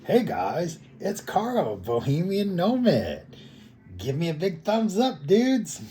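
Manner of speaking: high, loud mock YouTuber greeting; hyped video intro. YouTuber greeting viewers as a commenter requested, hammed-up hype. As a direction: ham up an over-excited channel opener. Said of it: "you need to start your video like".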